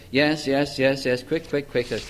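rapid, perfunctory repetition, voice dropped; hurried mock reading. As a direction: rattle it off like skimming to get done. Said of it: "Do you read it like that?".

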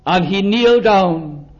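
usual speaking voice

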